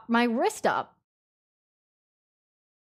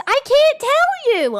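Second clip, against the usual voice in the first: teasing singsong; 5 dB louder, slower than usual. Acted. Teasing chat, secretive.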